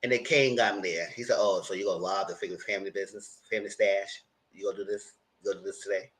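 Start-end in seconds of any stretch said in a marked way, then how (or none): 1.4-6.1 quiet, understated challenge; imitated hushed confrontation; low-key confrontation. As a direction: question him softly, voice held down. Acting out Kane confronting his brother.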